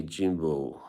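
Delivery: normal talking voice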